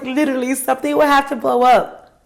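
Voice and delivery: light high mock disbelief; imitating the boss. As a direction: echo her astonished tone. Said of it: "That's how she said it".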